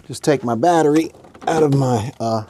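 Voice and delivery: normal talking voice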